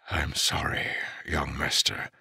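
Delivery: quiet sorry to the grieving master; whispered. A narrator's reading. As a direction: say it gently under your breath. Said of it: "Frost whispered".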